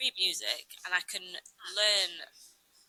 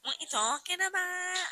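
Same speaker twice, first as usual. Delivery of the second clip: imitating daughter; 12 semitones higher than usual. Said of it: "She's like".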